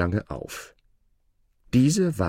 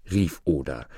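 ordinary delivery

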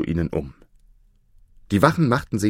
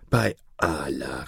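slow, low, hushed exclamation; hushed dismay, drawn out slowly; dismayed cry; 5 semitones lower, 4 dB quieter, slower than usual. A narrator's reading. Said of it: "rief er".

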